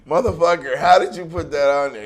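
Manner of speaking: loud, high-pitched retold outburst; indignant outburst. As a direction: raise your voice in mock outrage.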